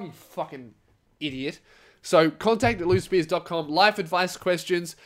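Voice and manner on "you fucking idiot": soft, under-the-breath jab; trailing off into quiet scorn; muttered mock insult. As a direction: mutter it in pretend disgust. Muttered insult ending pretend quarrel.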